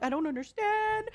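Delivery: high-pitched, laughing imitation of wailing; mock crying. Streamer reliving computer breaking after update, mock crying. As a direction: whine it out as a hammed-up sob. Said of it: "and I'm crying. I'm like".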